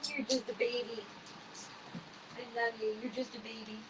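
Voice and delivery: quiet tender coo; soft cooing. Real, not acted. Owner soothing the stressed dog, quiet coo. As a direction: soothe the anxious pet.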